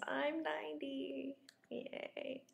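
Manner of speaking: normal talking voice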